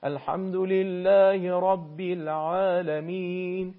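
slow chanted الحمد لله رب العالمین with a light ع. Shown as a demonstration. Teacher giving the model reading: slow relaxed recitation. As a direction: recite evenly, an easy ع in العالمین.

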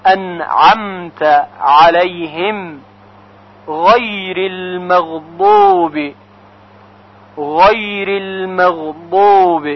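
انعمت علیهم غیر المغضوب with throaty ʿayn and gargled ghayn, slow; clear ʿayn and ghayn. Shown as a demonstration. Teacher models throat letters in the verse. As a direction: stress the throat letters slowly. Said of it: "باید با عین تلفظ".

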